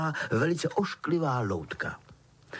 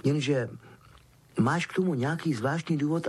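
ordinary delivery, nothing marked

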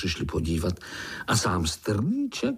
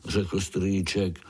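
plain reading voice

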